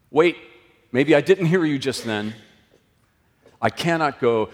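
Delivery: ordinary talk, nothing marked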